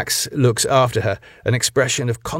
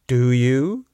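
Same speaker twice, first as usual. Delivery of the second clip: menacing question; 2 semitones higher than usual. A narrator's reading. Wife menacingly presses the question.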